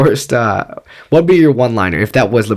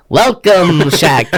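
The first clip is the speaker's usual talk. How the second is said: high, grand welcome; hammed-up wizard host; wizard dungeon master; 5 semitones higher, much slower than usual. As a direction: boom a mock-epic greeting.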